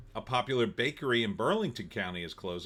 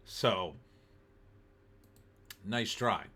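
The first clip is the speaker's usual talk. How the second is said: ordinary delivery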